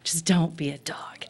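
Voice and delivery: soft, pleading words that fade into an unvoiced whisper; hushed plea. Alone on a hot night road, quietly begging it isn't a dog. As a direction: beg it under your breath, down to a whisper. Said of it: "and I was like".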